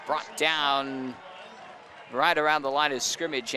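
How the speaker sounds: high play-by-play call; live play-by-play. Real, not acted.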